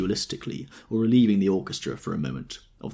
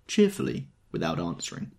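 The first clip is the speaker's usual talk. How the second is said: plain reading voice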